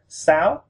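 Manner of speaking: "sao" sliding up in pitch; rising tone. Shown as a demonstration. Teacher giving the correct six, "sao" with rising tone. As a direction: raise the voice through "sao". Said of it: "raise our, our voice up".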